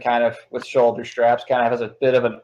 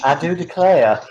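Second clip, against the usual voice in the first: mock Southern Belle; bad Southern Belle; 6 semitones higher, 4 dB louder than usual. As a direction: ham up a terrible Southern Belle. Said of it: "my Southern Belle horrible on purpose".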